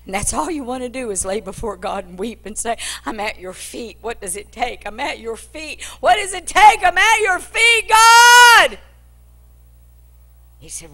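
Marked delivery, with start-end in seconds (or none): 3.1-8.8 pleading prayer. Acted. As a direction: break open, begging God. Mother weeping before God.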